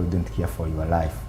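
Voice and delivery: usual speaking voice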